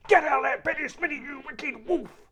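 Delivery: very loud, high angry bellow; father yelling at the wicked wolf to get out; furious shout. Acted. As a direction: roar him out of the bed. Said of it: "he shouted".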